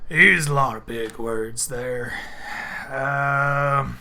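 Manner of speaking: lightly voiced puzzled orc barman; a confused barkeep scratching his chin.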